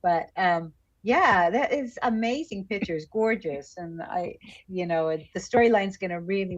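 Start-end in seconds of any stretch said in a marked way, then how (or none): none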